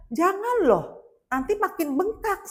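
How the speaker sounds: high-pitched, emphatic retold plea; insistent warning. Speaker to limping woman, warning her insistently. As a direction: urge her sharply not to refuse. Urging injured woman to use wheelchair.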